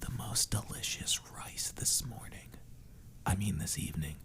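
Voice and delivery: ASMR whisper. Pretend. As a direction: draw the words out softly into the mic, ASMR style. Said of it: "You're just like".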